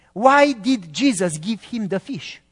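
normal talking voice

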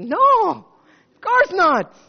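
loud, high, drawn-out no; emphatic refusal. Retold or imitated. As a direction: cry out a firm, stretched refusal. Exclaims refusal to student by phone.

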